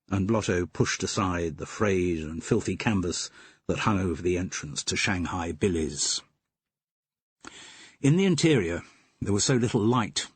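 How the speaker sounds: plain reading voice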